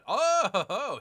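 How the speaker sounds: sudden high loud oh; a loud surprised gasp of a word; startled oh. Real, not acted. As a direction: caught off guard, burst out. Sees enemy taking his city.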